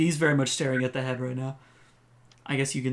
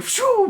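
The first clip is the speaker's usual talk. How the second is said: panicked whoosh; 6 dB louder than usual. Acted. Kid fleeing in panic.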